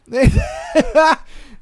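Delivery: loud high burst of laughter. Real, not acted. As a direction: crack up at your own story. Laughs after the story.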